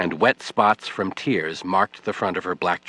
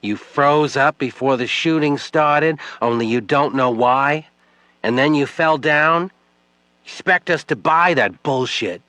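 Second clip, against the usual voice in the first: scornful, higher and louder; angry accusation; 6 semitones higher, 5 dB louder than usual. Acted. Widow accusing Webb before the crowd, scornful shout. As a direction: throw the accusation in his face.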